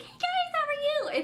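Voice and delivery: very high chirpy hey guys; fake cheery vlogger. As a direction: squeal it like a fake happy vlogger. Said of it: "vlog and be like".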